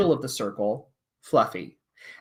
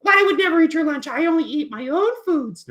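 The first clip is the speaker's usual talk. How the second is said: high, fast indignant cat puppet; 6 dB louder than usual. Acted. Cat puppet denies eating teacher's lunch, high and indignant. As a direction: protest in a squeaky kitty voice.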